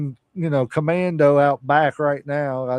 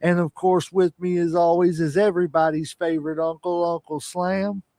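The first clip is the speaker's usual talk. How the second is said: grand entrance announcement; 4 semitones higher than usual. Acted. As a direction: introduce the champ to the arena. Wrestling-style grand entrance intro.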